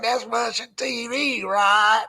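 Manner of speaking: slow, snooty mocking imitation; stuck-up mimicry. Host imitates co-host's remark on Russian TV, stuck-up mock. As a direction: draw it out like a snob, teasing. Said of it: "You stuck up your nose like".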